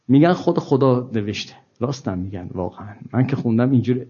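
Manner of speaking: ordinary talk, nothing marked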